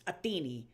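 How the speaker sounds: Athene, ending in an ee sound; Classical Attic pronunciation. Shown as a demonstration. Streamer shows chat the classical Attic Athene, Attic pronunciation. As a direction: say Athene as ah-THEE-nee. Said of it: "it would have been pronounced".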